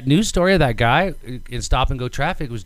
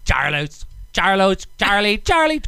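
hammed-up Mexican accent, rising; playful thick Mexican accent; 6 semitones higher than usual. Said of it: "imagine you have a thick Mexican accent".

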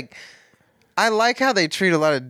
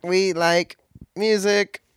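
slow alien announcement; deadpan space visitor; 5 semitones higher, slower than usual. Pretend. Joining the alien bit.

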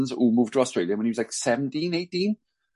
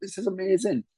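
amazed; 8 semitones higher than usual. Retold or imitated. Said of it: "just like".

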